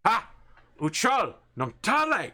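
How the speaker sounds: short, high, loud bursts in an invented tongue; barked creature orders. Acted. Captor orders a struggling prisoner down, loud barked commands. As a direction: bark commands like a hostile captor.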